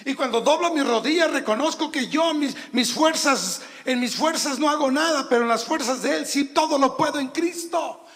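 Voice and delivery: high, loud preaching build; rising sermon fervor; fervent preaching. Acted. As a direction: lift it up with fervor, full voice. Preaching climax.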